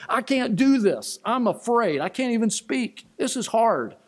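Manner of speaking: high, pleading, afraid Moses; frightened Moses. Acted. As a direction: plead with God like a scared man who can't speak. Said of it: "Moses said to God himself".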